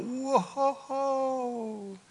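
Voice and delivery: long, high drawn-out whoa; mock astonishment, stretched out high; startled Zechariah. Startled priest Zechariah facing the angel, high drawn-out amazement. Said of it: "of course, he's like".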